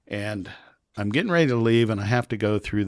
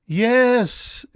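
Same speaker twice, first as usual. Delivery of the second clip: much higher, louder yes; mock eager yes; 8 dB louder than usual. Traveler placating the officer, high eager yes. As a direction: humor him.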